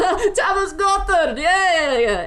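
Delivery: high, loud laughing exclamation. Acted. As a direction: crow it through a big acted laugh. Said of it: "the man just laughs and goes".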